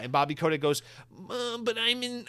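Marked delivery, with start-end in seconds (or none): 1.3-2.3 much higher-pitched protest; hesitant, high mock voice making excuses; whiny underling. Bought CEO stammering excuses to his new boss, high mock protest.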